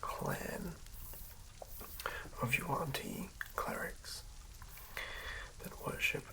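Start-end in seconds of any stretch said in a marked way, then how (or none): none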